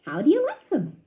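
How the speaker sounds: high cheerful mouse voice; friendly young mouse; Ced voice. A narrator's reading. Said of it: "asked Ced".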